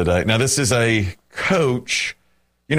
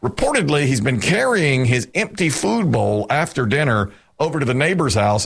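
usual speaking voice

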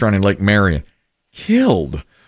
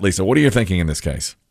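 ordinary delivery

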